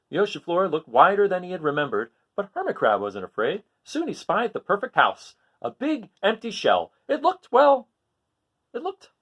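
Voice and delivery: lively picture-book reading, rising on each list item; story narration. A narrator's reading. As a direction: read it to children, animated and sing-song. Reads picture book aloud.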